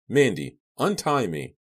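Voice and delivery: exasperated command; urgent demand. A narrator's reading. Captive orders captor to free him.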